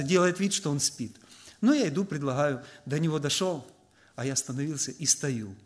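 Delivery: ordinary delivery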